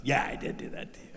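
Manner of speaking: hushed whispered confession; soft whispered admission; sly whisper. Retold or imitated. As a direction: let it slip out under your breath. Terry quietly admits drugging him.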